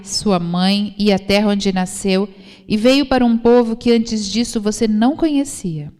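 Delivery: ordinary talk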